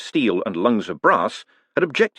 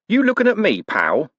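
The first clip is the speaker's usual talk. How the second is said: a hint of a high menacing tough; lightly voiced thug picking a fight; menacing pal; 12 semitones higher, 3 dB louder than usual. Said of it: "the cheery of the word pal".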